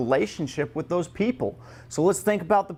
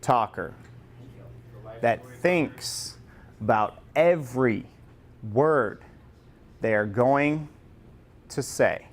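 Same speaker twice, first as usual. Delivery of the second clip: drawn out with long pauses between words; a light demo of someone weighing every word; slow talker; 5 semitones lower, slower than usual. Shown as a demonstration. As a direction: act out a slow, halting talker. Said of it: "that slow talker".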